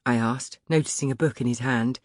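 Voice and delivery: plain reading voice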